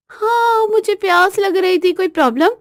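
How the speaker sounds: high-pitched, unrepentant retort; cheeky high challenge; brazen wife. Acted. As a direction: dare him to object. Wife challenges shocked husband.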